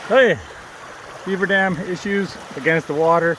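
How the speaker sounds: loud call across the water. Real, not acted. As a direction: shout over to the other canoe. Calling out to other canoe.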